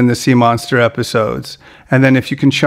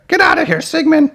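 very high cartoon monster mama; Big Mama impression. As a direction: shoo someone off in a high put-on squawk. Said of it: "Big Mama is here".

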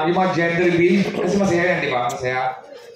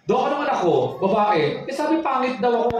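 higher, put-upon patient voice; mock patient voice; 7 semitones higher than usual. Pregnant woman answering the doctor in a high put-upon voice.

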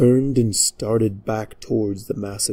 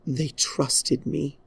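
grieving princess; 5 semitones higher, 3 dB quieter, slower than usual. Acted. Princess in grief.

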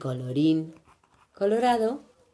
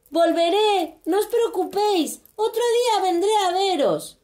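agudo, tranquilizador y rápido; promételo con cariño; consuelo de niño; 11 semitones higher than usual. A narrator's reading. Max consuela a los monstruos. (Max tranquiliza, voz aguda)

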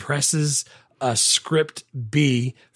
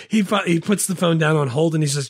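ordinary delivery

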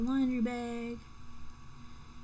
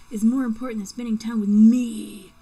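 much louder, hammed-up jealous rant; mock angry outburst; 13 dB louder than usual. Boyfriend ranting at Elsa about her job, loud. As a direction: let it burst out loud.

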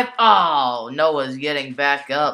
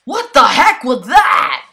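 excited shout; 8 semitones higher, 12 dB louder, slower than usual. Real, not acted. Stunned at a huge wrestling move.

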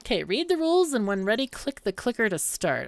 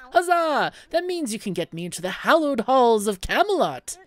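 higher, louder cheer of joy; delighted mage; 5 semitones higher, 6 dB louder than usual. Acted. Mage cheers that the sellsword can get him into Camelot. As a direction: burst out in triumph.

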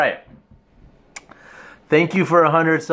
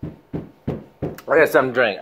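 usual speaking voice

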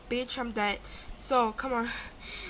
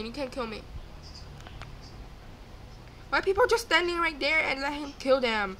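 ordinary delivery

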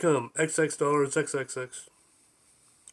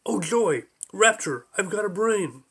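higher, louder mock rapture; joyful exclamation; 5 semitones higher, 4 dB louder than usual. A narrator's reading. Slug rejoicing at having a brain, joyful. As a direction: exclaim it in bursts of delight.

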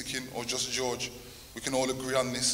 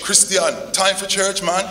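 loud, higher-pitched wake-up call; imitated fatherly call; 7 semitones higher, 9 dB louder, slower than usual. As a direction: call the boys up like Dad would. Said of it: "then he would come back in again".

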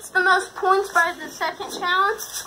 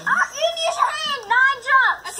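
laughing call-out; 8 semitones higher, slower than usual. Real, not acted.

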